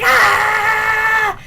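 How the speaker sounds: loud, drawn-out mock scream; imitated scream. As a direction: scream like a man stuck in a nightmare. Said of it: "Adrian just starts screaming".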